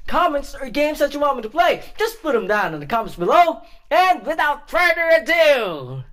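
high, loud, fast video intro; upbeat channel intro. Real, not acted. Host welcomes viewers to another episode, upbeat intro. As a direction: rattle off a bouncy welcome.

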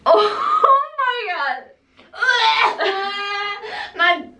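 high squealing laugh of disgust; shriek-laugh at the awful smell. Real, not acted. Reacting to jelly bean smell.

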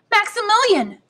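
high, loud cry of joy; joyful cry. A narrator's reading. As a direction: call the name out in delighted surprise. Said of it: "with an exclamation of joy".

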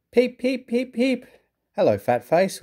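high, whistle-like cheeky engine call; whistling cheekily. A narrator's reading. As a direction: pipe it out like a cheeky steam whistle. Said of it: "whistled Henry".

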